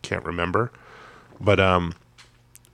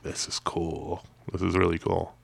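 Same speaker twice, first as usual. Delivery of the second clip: quiet awe; 2 semitones lower, 7 dB quieter than usual. Retold or imitated. Quoting own quiet awe at idol.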